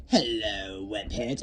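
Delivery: sneering Goblin greeting; a put-on villain voice for the Goblin. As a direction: taunt them like a grinning villain.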